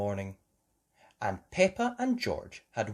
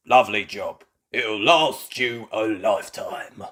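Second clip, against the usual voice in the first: proud builder voice; 8 dB louder than usual. A narrator's reading. Bull boasts.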